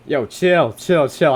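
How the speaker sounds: loud, high alarmed pleading; startled shout. Real, not acted. Streamer startled by sudden entities, alarmed shouting. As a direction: shout at the game to calm down.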